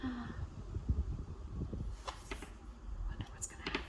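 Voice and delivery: soft suspenseful whisper. Acted. Aside to listeners, suspense.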